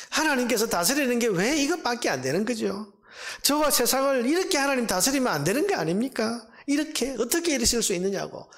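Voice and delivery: high, pleading objection; protesting complaint. Acted. Believer objecting to God's rule, protesting complaint. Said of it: "그렇게 항의하고 불평하고".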